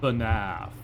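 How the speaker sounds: low, portentous mock movie line; mock-dramatic title drop. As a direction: drop low and pause before the name. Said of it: "where they were like".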